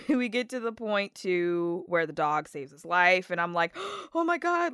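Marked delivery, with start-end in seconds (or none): 3.7-4.7 high gasped dismay; mock-horrified, pitched high; reliving shock. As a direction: gasp it, retelling a moment of alarm. Reliving shock at dog's peril.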